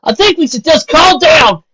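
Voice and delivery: high, loud plea to calm down; loud call for calm. A narrator's reading. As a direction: shout them back to calm. Applejack tries calming group.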